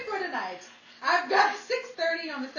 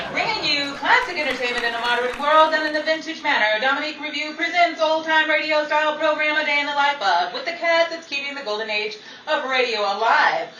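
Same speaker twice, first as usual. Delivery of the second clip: louder vintage announcer read; radio announcer; 3 semitones higher, 11 dB louder than usual. Acted. Announcer introduces the cast, classic radio announcer voice. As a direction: present the cast like golden-age radio. Announcer reads program credits.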